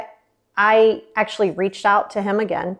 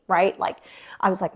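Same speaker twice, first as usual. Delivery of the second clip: ordinary talk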